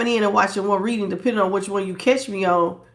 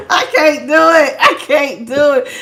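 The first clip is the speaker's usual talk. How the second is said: loud, higher, breaking up with laughter; real laughter bubbling through raised voice; laughing protest; 5 semitones higher, 7 dB louder than usual. Real, not acted. Gives up on the demo, laughing.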